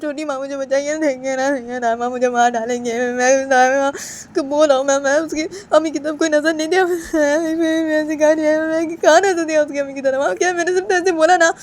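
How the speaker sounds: very high, loud tearful protest; crying denial. Acted. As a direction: sob out the denial, pleading. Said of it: "तो बानी वो ऐसे सब बातें बताने लगती है". (बहुत ऊँची रोती हुई सफ़ाई)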